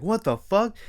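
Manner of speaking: high, stunned what the fuck; shocked exclamation. Retold or imitated. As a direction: react as if you just saw a killing. Machete thrown into girl's head.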